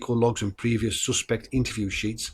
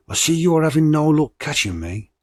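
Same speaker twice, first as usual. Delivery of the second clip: louder, higher mock taunt; 5 semitones higher, 5 dB louder than usual. Hoaxer taunting George Oldfield, a louder mock jeer. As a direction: jeer at the detective a little. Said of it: "I'm not gonna do the accent, but I'm gonna read".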